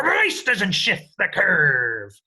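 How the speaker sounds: pirate voice. Shown as a demonstration. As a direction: bellow it like a pirate captain. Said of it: "in your very best pirate voice".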